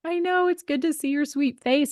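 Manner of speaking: bright, high-pitched happy hello; excited greeting. Real, not acted. Host greeting her guest on the podcast, excited and high.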